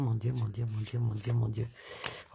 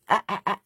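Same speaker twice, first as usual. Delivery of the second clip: mock drowning gasps; 6 semitones higher, 4 dB louder, faster than usual. Imitates someone drowning.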